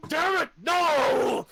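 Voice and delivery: loud, slow, raging cry; giant's furious shout. Acted. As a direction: bellow it out in fury. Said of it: "the giant goes, shout".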